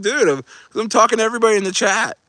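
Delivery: high, rushed, laughing protest; mock protest. Streamer defending himself to a kid in chat, mock protest. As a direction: plead your case fast and high.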